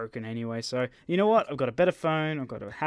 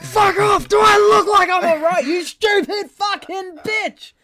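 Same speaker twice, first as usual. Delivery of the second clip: screaming, much higher and louder; furious shout; 10 dB louder, slower than usual. Retold or imitated. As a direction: scream it in blind rage. Reenacting his outburst at lady.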